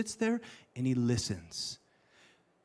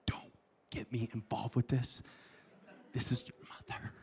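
whispered aside; 5 semitones lower, 11 dB quieter than usual. Acted. Joseph backing out.

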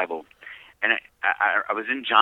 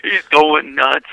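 loud emphatic; 6 semitones higher, 12 dB louder, slower than usual. Real, not acted. Emphatic laugh about bunkie.

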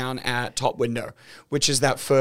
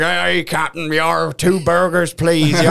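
a hint of a put-on drive-through voice; lightly goofy intercom voice; mild mock speaker announcement; silly speaker voice; 3 semitones higher, 4 dB louder, slower than usual. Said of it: "noises and voices in the speakers".

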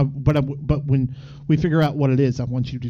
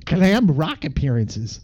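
Canadian reading aloud; Canadian accent; 3 semitones higher than usual. Acted. Reading about eighties metal as a Canadian, Canadian accent. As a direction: read it out in a folksy Canadian accent, with eh. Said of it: "read by a Canadian".

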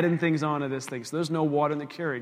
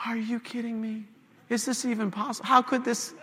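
pitched-up, mock-despairing questions; exasperated disbelief; 8 semitones higher than usual. Man watching the garbage man go, exasperated disbelief. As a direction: plead upward in comic disbelief.

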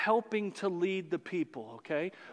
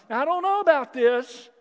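higher, louder doubtful grumble; the wary crowd doubting Moses, louder and higher; skeptical people; 6 semitones higher, 8 dB louder than usual. Retold or imitated. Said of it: "They're like".

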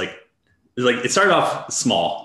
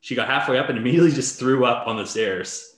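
normal talking voice

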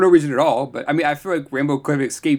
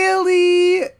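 incredulous; 14 semitones higher, 3 dB louder than usual. Retold or imitated. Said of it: "I was just like".

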